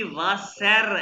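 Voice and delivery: वासर with a fully sounded final R; wrong pronunciation. Shown as a demonstration. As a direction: say वासर with the written R rolled out. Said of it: "इसको वासर नहीं कहना". (वासर में आख़िरी र साफ़ बोलकर)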